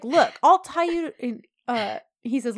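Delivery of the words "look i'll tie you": high, loud, slowed mock threat; hammed-up threat in a quarrel; heated threat. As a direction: act out her raised-voice warning. Acted threat, first given as hers.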